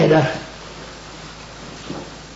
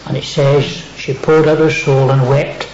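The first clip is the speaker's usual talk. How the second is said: ordinary talk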